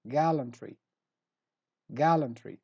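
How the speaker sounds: "gallantry" with the first syllable stressed; stress on GAL. Shown as a demonstration. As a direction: hit "gal" at the start of "gallantry". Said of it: "So stress gal".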